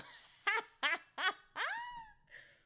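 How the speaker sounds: long, high, loud peals of laughter; laughing hard. Real, not acted. Cracking up at her own words, laughing hard. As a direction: laugh helplessly, climbing high. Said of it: "laughing so hard".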